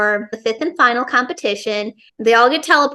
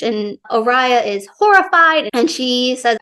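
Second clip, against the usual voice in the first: ordinary talk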